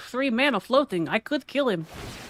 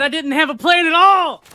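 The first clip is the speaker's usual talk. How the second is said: loud shouted admission, higher; exasperated shout; 3 semitones higher, 6 dB louder than usual. Real, not acted. Admitting his bad play to the opponent, loud shout. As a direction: yell it out in mock frustration. Exclaims in game.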